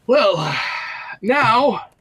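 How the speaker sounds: a hint of high wizard drawl; lightly voiced wizard. Wizard addressing the bound party, high drawn opening.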